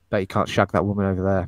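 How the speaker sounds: low, goading bartender; taunting dare. Acted. Bartender dares Cruise in the acted scene, taunting. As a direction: goad him with a low-pitched challenge.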